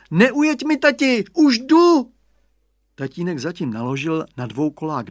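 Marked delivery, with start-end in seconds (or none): 0.1-2.1 put-on very high, loud child's shout; calling out. A narrator's reading. Boy shouting from the window so father waits, very high and loud. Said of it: "vyklonil z okna a volal".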